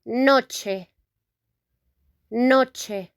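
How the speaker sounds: noche with a crisp ch and pure final e; teacher modelling noche; Spanish pronunciation. Shown as a demonstration. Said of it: "we say".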